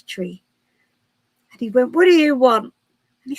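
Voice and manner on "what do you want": loud, higher, irritated demand; mock-cross, raised voice; grumpy Santa. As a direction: act out a grumpy answer at the door. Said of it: "having a right grump".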